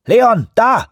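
high, loud sudden call; urgent shout. A narrator's reading.

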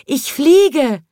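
high, loud cry of delight; joyful shout. A narrator's reading. Chimney sweep discovering he can fly, shouting high. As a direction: shout it as you take off. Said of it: "schrie er".

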